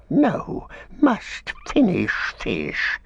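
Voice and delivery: high then slow creature whine; stubborn Gollum. A narrator's reading. Gollum refusing to leave his fish, in a creature whine.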